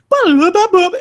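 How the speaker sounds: high loud sung jingle; game jingle imitation. Imitating the game's pickup sound, high and loud. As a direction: mimic the little pickup sound.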